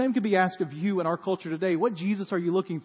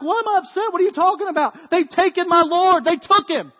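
Mary yelling much higher and louder at the disciples; distraught outburst; 12 semitones higher, 12 dB louder than usual. Retold or imitated. Mary to the disciples at the empty tomb, loud distraught outcry. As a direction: shout it out, grief turned to fury. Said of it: "She looks at the guys like".

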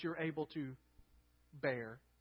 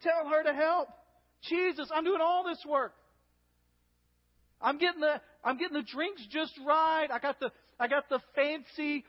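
high, loud, harried plea; urgent complaint; 7 semitones higher, 6 dB louder than usual. Acted. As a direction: act out an overworked sister demanding help. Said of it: "You hear the urgency in her voice".

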